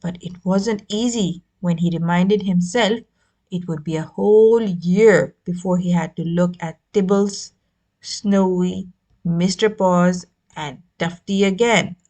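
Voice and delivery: ordinary delivery, nothing marked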